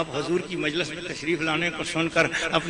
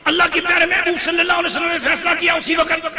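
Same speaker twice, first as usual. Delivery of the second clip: high, driving sermon delivery; full-force preaching; 10 semitones higher, 6 dB louder than usual. Real, not acted. Preacher explaining the Prophet's ruling to the crowd, full-force preaching. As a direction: build authority phrase upon phrase.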